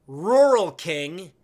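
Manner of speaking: drawn-out loud name; hammed-up slow shout; mock announcer call. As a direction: belt it out slowly like an announcer.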